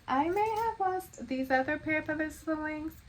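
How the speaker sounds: normal talking voice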